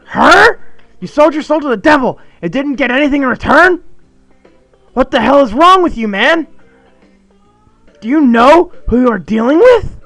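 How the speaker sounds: high, loud frantic tirade; panic attack rant. Acted. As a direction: scold his friend in wild panic. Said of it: "panic attack".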